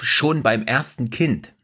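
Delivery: "schon" pushed hardest, then "beim ersten Kind" slow and deliberate; stress on schon. Shown as a demonstration. Host correcting listeners, slow emphasis on "schon". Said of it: "Meine Betonung lag mehr auf".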